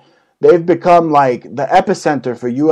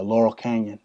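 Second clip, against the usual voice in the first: ordinary talk, nothing marked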